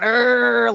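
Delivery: short throaty growl like a creature; imitated growl. Retelling her hypnosis, growling like the creature. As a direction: growl it out, teeth bared. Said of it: "making a growly face and showing my teeth".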